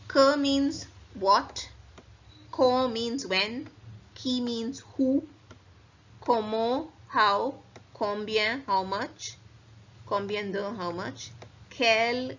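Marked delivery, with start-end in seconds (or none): 0.1-10.9 slow, each question word set apart; slow vocabulary drill. Shown as a demonstration. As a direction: spell the words out slowly for learners.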